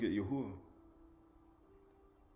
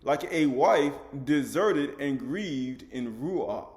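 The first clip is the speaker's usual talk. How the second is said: very slow, word by word; teaching repeat, extra slow; 3 dB louder, much slower than usual. Shown as a demonstration. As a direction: drag it out so it sinks in. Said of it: "Like what?".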